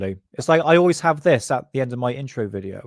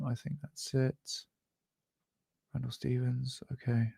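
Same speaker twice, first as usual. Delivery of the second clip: quiet muttering; 13 dB quieter than usual. Real, not acted. Muttering to himself while checking notes.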